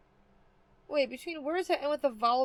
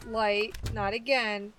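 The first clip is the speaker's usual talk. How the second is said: ordinary talk, nothing marked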